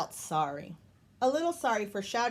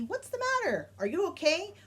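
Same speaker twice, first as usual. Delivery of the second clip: imitating concerned askers; 10 semitones higher than usual. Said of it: "people that ask me".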